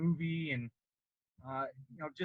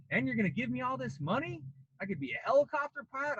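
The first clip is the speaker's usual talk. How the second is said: high, fast, wide-eyed; naive excitement; 7 semitones higher than usual. Retold or imitated. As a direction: sound like a kid sold on a dream. His naive younger self, excited.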